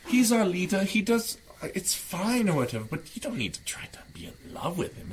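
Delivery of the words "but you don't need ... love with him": whispering. Acted. Confiding about leader nearby.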